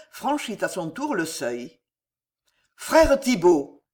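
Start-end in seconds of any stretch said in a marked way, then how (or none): 2.7-3.8 louder, higher, drawn-out cry of greeting; slow, raised exclamation; exclaiming. A narrator's reading. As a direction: call out as you rush to meet him. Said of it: "s'écria Lubin en s'élançant".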